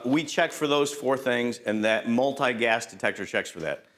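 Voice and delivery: ordinary delivery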